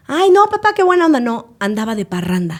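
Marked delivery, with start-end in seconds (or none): 0.1-1.2 high, loud delighted cry; a daughter squealing with mock delight at her father's joke. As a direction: gush at the joke like a happy daughter. Daughter answers her joking father.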